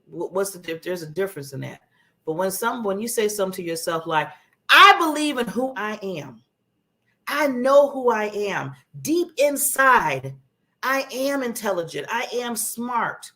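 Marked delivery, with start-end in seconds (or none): 4.7-13.3 slow, emphatic self-affirmation; firm, deliberate, self-assured delivery; confident. Shown as a demonstration. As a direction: declare it with real conviction, drawing words out. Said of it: "Isn't that a little bit more, sound more confident".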